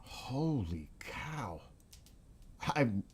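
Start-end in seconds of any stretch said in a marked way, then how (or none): 0.0-1.6 slow whispered holy cow; hushed disbelief; awed whisper. Real, not acted. Reacting to thirteen-story snowdrifts.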